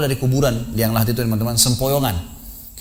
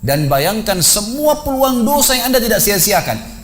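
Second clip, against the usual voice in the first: high, insistent preaching, stretched at the start; impassioned exhortation; 8 semitones higher, 3 dB louder than usual. Retold or imitated. Preacher making the man in the shroud picture his sins, impassioned high exhortation. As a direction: drive the list on with a raised, urgent pitch.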